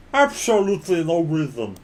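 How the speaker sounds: talking through a mouthful of cookie; mouth full. Acted. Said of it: "talk while my mouth is full of cookie".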